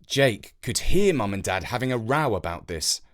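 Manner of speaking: ordinary delivery, nothing marked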